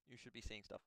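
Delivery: normal talking voice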